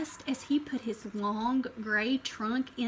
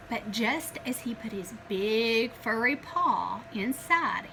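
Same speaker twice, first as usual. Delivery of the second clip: ordinary delivery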